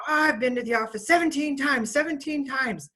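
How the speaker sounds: high, loud mock boast; imitated bragging. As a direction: imitate a kid showing off. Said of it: "he was bragging about how many times".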